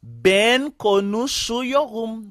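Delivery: slow, drawn-out model sentence; careful slow teaching pronunciation; slow demonstration. Shown as a demonstration. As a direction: say it word by word for learners. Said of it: "eh bien là moi pral dire".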